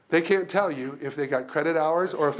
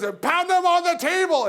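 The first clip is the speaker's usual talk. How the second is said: Mark Stelzner; 6 dB louder than usual. Retold or imitated. Announcer urging the crowd to pound drumsticks, rousing bellow. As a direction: roar it out to rally the hall. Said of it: "gorgeous baritone voice".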